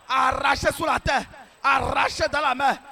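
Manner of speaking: ordinary talk, nothing marked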